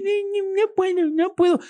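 very high, ridiculous finger-in-nose whine; imitating Tony Robbins's silly voice. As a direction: imitate a grown man squeaking like a fool. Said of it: "y hablando así".

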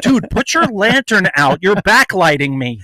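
mock old-time soldier. Mock period soldier complaint.

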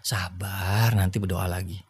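low, soft and steady; calm reassurance. Retold or imitated. Husband calming his worried wife, low and soft. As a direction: soothe her gently, voice dropped.

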